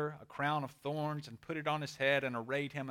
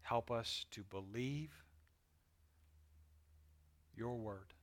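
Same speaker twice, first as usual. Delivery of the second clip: reverent prayer; 4 semitones lower, 7 dB quieter than usual. Real, not acted. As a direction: pray quietly, phrase by phrase. Leads a closing prayer.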